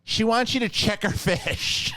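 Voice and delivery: high, slow relayed request; exasperated cashier. Retold or imitated. Cashier relaying the customer's demand to Aaron, high exasperated imitation. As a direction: imitate a clerk spelling out an absurd request. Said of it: "she goes".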